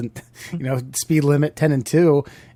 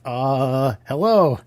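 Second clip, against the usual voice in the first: higher, louder, flustered caller; unsure caller; 6 semitones higher, 4 dB louder than usual. Retold or imitated. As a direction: play a nervous first-time claimant. Acting out an unsure insurance caller.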